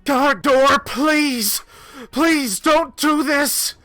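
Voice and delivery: high, loud, frantic begging; desperate plea. Acted. Commoner woman throws herself between Gardor and the Manticore, desperate high pleading. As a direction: beg him to stop, voice up high and straining. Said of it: "and she says".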